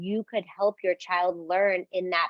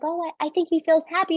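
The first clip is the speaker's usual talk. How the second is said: put-on quick, higher little-kid answer; a hint of a young child chiming in; lightly voiced child; 9 semitones higher, 4 dB louder, much faster than usual. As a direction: play a small child, a bit higher and fast. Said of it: "your child might be like".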